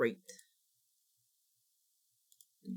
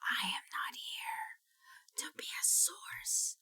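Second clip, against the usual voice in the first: very slow, menacing whisper; 6 semitones higher, 9 dB quieter, much slower than usual. Pretend. Telling viewers she's done with good deeds, a slow menacing whisper. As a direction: breathe it out like a dark threat.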